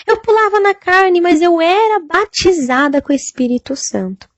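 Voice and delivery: "Eu pulava na carne" cried out high; crying out. Retold or imitated. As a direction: wail it as a tormented young woman.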